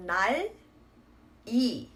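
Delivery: 날 이 split as nal, then i; two separate syllables, 날 and 이, nothing carried over; as written. Shown as a demonstration. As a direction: close 날 on the l, pause, then a bare 이. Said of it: "or should be read as".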